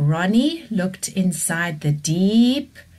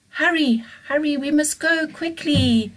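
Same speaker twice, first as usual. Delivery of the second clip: higher, pressing call to flee; urgent hurry; 9 semitones higher than usual. Acted. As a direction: rush them away from the lava. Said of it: "the dinosaurs said".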